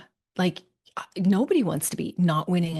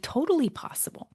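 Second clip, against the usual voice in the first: emphatic reassurance; 8 semitones higher than usual. Retold or imitated. Reassuring client, emphatic answer.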